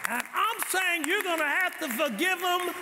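rising, slowed preaching climax; preaching build. Acted. Pastor building to a climax for the church, slow and high. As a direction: lift the church toward forgiveness.

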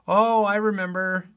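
high, loud burst of mock realization; mock sudden recall. Teacher playing a student recalling notes, mock sudden recall. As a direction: act out the answer suddenly coming back to you.

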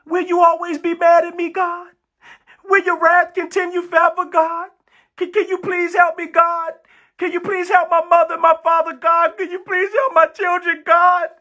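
high-pitched, sustained mock prayer; hammed-up begging, voice held high; begging sinner. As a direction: imitate a desperate plea to God. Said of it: "That's how you talk".

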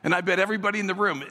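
ordinary talk

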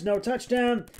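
usual speaking voice

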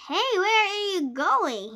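urgent challenge. A narrator's reading. Friend grabbing his arm at the door, urgent challenge. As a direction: call after someone heading off. Friend stops narrator in story.

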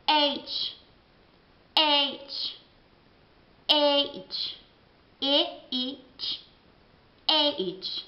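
H said as aitch, spelled out; English H. Shown as a demonstration. Teacher spelling out English H. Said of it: "si pronuncia totalmente differente".

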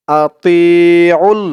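আতিউল stretched very slowly with a long final ল; slow recited আতিউল; drawn-out recitation. Shown as a demonstration. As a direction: chant আতিউল slowly, holding the ল. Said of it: "আইন লাম পেশ উল".

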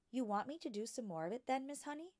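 put-on a bright young girl asking her teacher; young Matilda. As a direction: ask like a curious small girl. Matilda's childlike voice.

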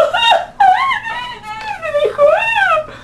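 very high shrieking laugh; high cackling laugh. Real, not acted. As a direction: squeal with amusement. Laughs after mocking.

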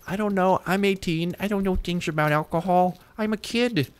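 put-on high, clueless little kid voice; silly kid voice. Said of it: "I don't know why I'm using this voice".

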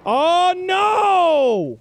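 long, loud, high wail; dismayed yell. Real, not acted. Player who just missed the shot, dismayed yell. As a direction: wail it out at full volume.

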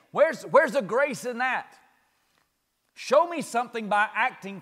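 loud pleading sermon; preaching. Acted. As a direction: build the challenge into a loud appeal.

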